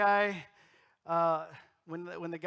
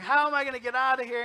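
a quick, louder panicked cry; 7 semitones higher, 9 dB louder, faster than usual. Retold or imitated. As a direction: blurt it out trapped in the dark grave. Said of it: "he's panicked".

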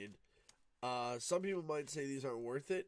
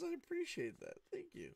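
squeaky thanks. Real, not acted. Thanks the pack playfully.

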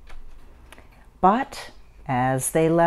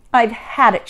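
higher, mildly fed-up voice; exasperated sigh; 5 semitones higher, 4 dB louder than usual. A narrator's reading. Mother crumples after losing tickets, fed-up sigh. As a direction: give up with a touch of exasperation. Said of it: "she sighed".

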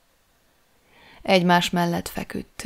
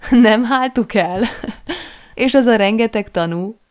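high, loud incredulous questions broken by laughter; helpless laughter, disbelieving; laughing outright; 5 semitones higher, 7 dB louder than usual. A narrator's reading. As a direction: burst out laughing as you echo it back. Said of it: "Ezúttal rendesen felnevettem".

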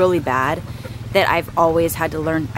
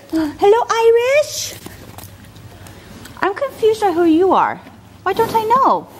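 pet talk. Real, not acted. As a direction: coo at the pets way up high. Cooing to the dog and horse.

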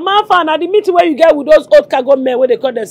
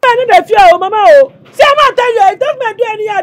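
angry outburst; 5 semitones higher, 6 dB louder than usual. Acted. Angry son warns about in-laws.

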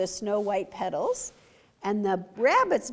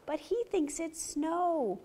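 ordinary delivery

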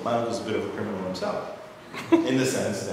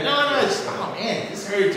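faked pain; 6 dB louder than usual. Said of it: "He's like".

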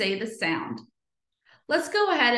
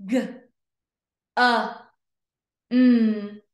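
G, a, m as separate sounds, m hummed; long gaps between G, a and m; sounded out slowly; 5 dB louder, much slower than usual. Shown as a demonstration. Teacher pointing to each dot, G-a-m sounded out. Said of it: "read each word slow".

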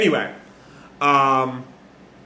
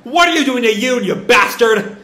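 mock furious shout, a bit higher and louder; angry yell; 8 semitones higher, 5 dB louder than usual. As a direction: yell it as she lunges with her sword. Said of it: "Main Yan lunges".

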